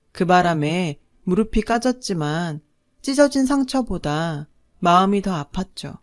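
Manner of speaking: ordinary delivery, nothing marked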